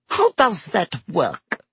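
high, slow, intrigued question; breathless with interest. A narrator's reading. Landlady asks about the Fidelius charm, breathless with interest. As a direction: ask with hushed fascination. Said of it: "breathless with interest".

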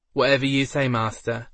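reply with a gentle lisp. A narrator's reading. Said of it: "Gronk replied with a lisp".

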